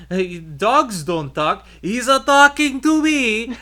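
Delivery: thick Italian-American mock voice, rising loud at the end; playful Italian-American accent, hammed up. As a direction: ham up an Italian-American guy. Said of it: "my uncles and I talk like that".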